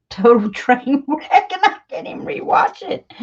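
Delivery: laughing through the words; real embarrassed laughter. Real, not acted. Vlogger on her first video, laughing helplessly. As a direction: break up laughing at your own effort.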